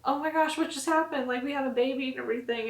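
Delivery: put-on new parents holding their baby, overjoyed; overjoyed disbelief. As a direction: marvel at what just happened. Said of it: "Dag and I were just like".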